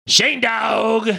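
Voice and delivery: high, drawn-out name call; announcer's call. Acted. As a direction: introduce the co-host like a hype man. Announcing the co-host.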